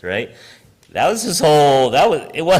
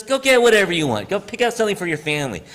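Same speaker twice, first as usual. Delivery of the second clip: higher, fast, eager offer; generous; 7 semitones higher, much faster than usual. Retold or imitated. Store owner happily telling kids to pick anything. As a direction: urge them happily to take anything. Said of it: "He goes".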